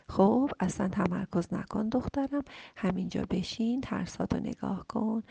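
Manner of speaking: ordinary talk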